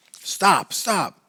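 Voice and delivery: high, loud desperate cry of stop; frantic yell. A narrator's reading. Father shouts at the baby he is shaking, loud and high. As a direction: lose control and shout it down at her.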